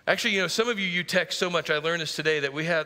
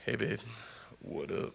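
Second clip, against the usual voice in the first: casual murmur; 6 semitones lower, 10 dB quieter than usual. Retold or imitated. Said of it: "throughout the day".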